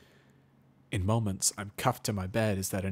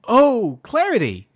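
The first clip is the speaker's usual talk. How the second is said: high, very loud sudden recognition; exclaiming; 15 dB louder, slower than usual. Acted. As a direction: burst out remembering. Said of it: "exclaims Twilight".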